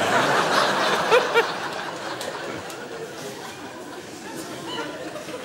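long, soft, breathless laugh; long quiet laugh. Real, not acted. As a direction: laugh silently to yourself. Laughs at own joke. (langes, leises, tonloses Lachen)

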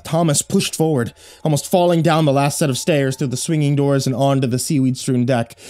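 ordinary delivery

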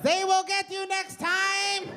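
very high, slow, drawn-out taunt; mock sinister voice. Taunting the offended fans in a high, slow mock voice. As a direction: croon the threat way up high and slow. Mocking the rival fans' side.

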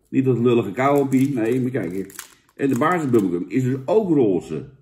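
ordinary talk, nothing marked

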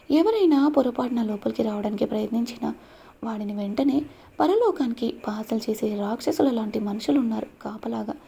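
usual speaking voice

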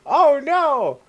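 high, loud cry of dismay; dismayed wail. Real, not acted. Gamer sent all the way back, dismayed wail. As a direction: wail at losing all the progress.

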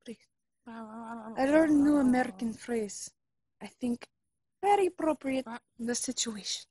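put-on insistent son, Russian accent; fake Russian accent. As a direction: argue with mama in Russian accent. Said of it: "fake Russian spy".

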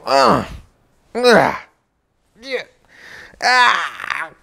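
high, effortful grunts with pauses; acted-out squeezing effort; straining grunts. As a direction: heave to close a tight buckle. Straining to snap a tight buckle.

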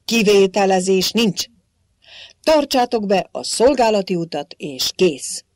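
loud, fast, curt guard's comeback; snapping retort. A narrator's reading. Head guard snapping back at the king. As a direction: brush him off hard. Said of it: "feleselt vissza a főőr".

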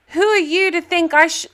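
a silly put-on British accent, tongue in cheek; bad British accent. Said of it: "my horrible British accent".

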